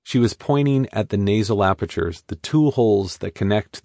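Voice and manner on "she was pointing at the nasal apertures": ordinary delivery, nothing marked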